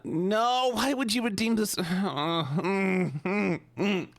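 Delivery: high, whiny, drawn out. Pretend. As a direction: whine like a spoiled maid. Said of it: "there's a whiny voice redeem".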